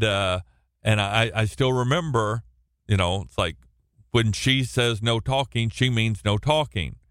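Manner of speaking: usual speaking voice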